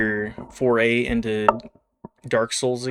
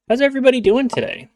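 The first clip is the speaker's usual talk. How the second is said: high, fast, loud streamer greeting; excited greeting; 12 semitones higher, 4 dB louder, faster than usual. Real, not acted. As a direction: bounce in with energy.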